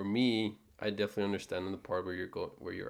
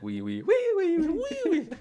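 loud, squeaky repeated squeals; mocking high voice; 9 dB louder than usual. Wife teasing husband about his voice, mocking squeak. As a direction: imitate a voice shooting up high. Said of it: "You're like".

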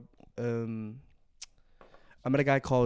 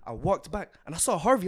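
ordinary talk, nothing marked

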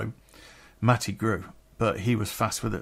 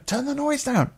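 calling over noise. Retold or imitated. Calling over a noisy party.

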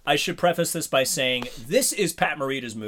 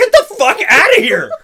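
laughing, shouted rant, much higher; mock furious yell; 12 dB louder than usual. Ranting at the movie postman, laughing mock fury. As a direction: yell him off the lawn through laughter.

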